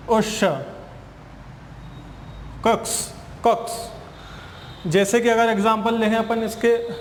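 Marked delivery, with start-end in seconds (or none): 2.7-3.9 slow, separate 'कक्स' with a hissed ending; 'कक्स' said slowly as a phonics sound; letter-sound demo. Shown as a demonstration. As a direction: sound out 'कक्स' as the X sound, softly released. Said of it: "कैसे इसको pronounce करते हैं".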